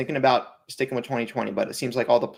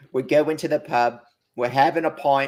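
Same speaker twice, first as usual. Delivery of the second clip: put-on light British accent on going to the pub; a hint of northern English; a casual mock-British voice; 3 semitones higher, 3 dB louder than usual. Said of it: "what part of Britain is that from?".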